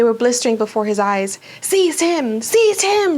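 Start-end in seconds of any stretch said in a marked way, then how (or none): 1.6-3.2 shrieked command. A narrator's reading. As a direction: repeat the order, climbing in pitch. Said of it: "shrieked Voldemort again".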